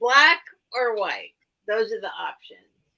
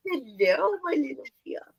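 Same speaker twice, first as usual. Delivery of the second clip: whispering lovingly to a toy car; tender whisper; 4 semitones higher than usual. Real, not acted. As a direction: coo it like greeting a pet. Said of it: "She's kissing it".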